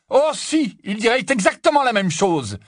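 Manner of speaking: very high, loud retort; heated contradiction. Acted. Harry insists Ron would say the same, heated. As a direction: cut in hard, dropping from a high start.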